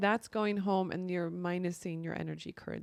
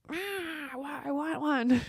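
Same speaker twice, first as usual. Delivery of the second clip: high, louder, hammed-up whine; mock frustrated whine; 7 semitones higher, 3 dB louder, slower than usual. Shown as a demonstration. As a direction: whine it like a sulky child. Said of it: "kind of jokingly".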